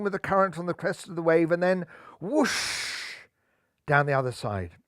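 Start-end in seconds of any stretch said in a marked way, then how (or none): none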